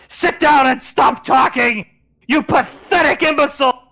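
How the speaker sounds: high, loud contemptuous shout; furious shout. Acted. Lorelai shouting down Scar's speech, furious. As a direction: scream insults at him.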